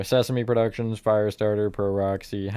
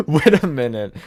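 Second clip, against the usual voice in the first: laughing; 5 semitones higher, 9 dB louder than usual. Real, not acted. Laughing at realization.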